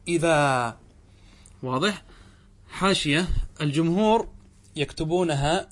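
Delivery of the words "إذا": إذا with a drawn-out final aa; pausal form idha. Shown as a demonstration. Teacher demonstrates pausing on idhan, long aa. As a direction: hold the alif, no n in إذا. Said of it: "فتقول في إذن".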